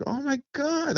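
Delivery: high astonished exclamation; overwhelmed at the sight; amazed. Retold or imitated. Watching his friend walk out with the band, high amazed gasp.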